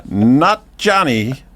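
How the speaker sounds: very slow, high, over-enunciated correction; exasperated scolding. Pretend. Storyteller to Johnny, just the dog talking, exasperated. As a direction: spell it out slowly as if to a dim listener.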